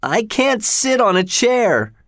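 high, slow, whiny complaint; crying boy. A narrator's reading. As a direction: wail it high like a tearful child. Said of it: "Linton started crying again".

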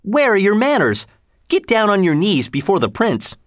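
put-on high, scolding boy's voice; a boy yelling at his friends to kneel; mock yell at friends. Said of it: "yelled at his friends".

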